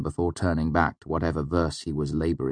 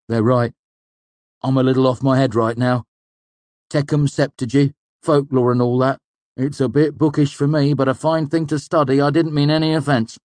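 loud, raised hearty apology; Manet, apologetic old student; 8 semitones higher, 7 dB louder than usual. A narrator's reading. Manet apologises to the boy, bluff and hearty. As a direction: make amends, loud and good-natured.

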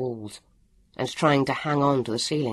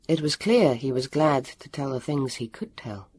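ordinary delivery

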